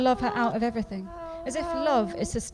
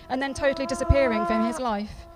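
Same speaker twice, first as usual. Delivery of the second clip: ordinary talk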